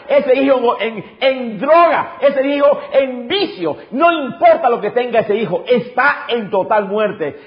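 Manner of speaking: high-pitched, driving sermon delivery; impassioned preaching. Real, not acted. Pastor urging parents about changing their children, impassioned preaching.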